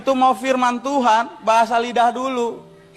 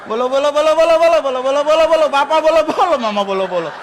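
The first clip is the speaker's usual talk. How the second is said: loud, high babbling chant; mock Tinatun tongues; 4 semitones higher, 5 dB louder, faster than usual. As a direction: belt out the bolo babble as a joke. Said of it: "Bahasa lidahnya persis Tinatun". (meniru bahasa lidah Tinatun, keras dan tinggi)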